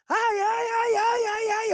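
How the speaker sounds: high-pitched wail of pain; imitated screaming. Son screams before the watching crowd, imitated high wail. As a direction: cry out in mock agony, pitched very high. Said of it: "ele começou a gritar".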